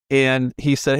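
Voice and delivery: ordinary talk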